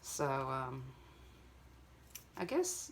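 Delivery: usual speaking voice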